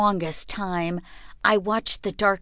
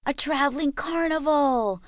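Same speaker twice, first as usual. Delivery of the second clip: high, thrilled running shout; yelling while running away; excited shout; 8 semitones higher than usual. A narrator's reading. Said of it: "my brother shouted".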